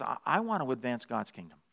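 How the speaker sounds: low, soft, humble dog; quiet voice of a dog serving its master; devoted dog. Acted. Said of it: "A dog says".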